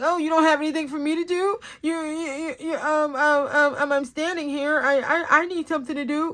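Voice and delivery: high, loud, stammering protest; pestering imitation, high and insistent; mock needy complaint. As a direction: mimic a coworker whining for work. Mimicking coworker's needy complaint.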